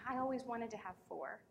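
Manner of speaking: soft retold sigh of longing; imitating a wistful stranger; hushed wistful reply. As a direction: start on a voiceless "oh", stay soft.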